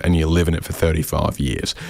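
ordinary talk

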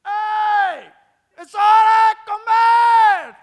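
put-on very loud, high shout to the kids; shouted call to eat. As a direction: bellow it up the stairs, slow and drawn out. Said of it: "No tengo que decir".

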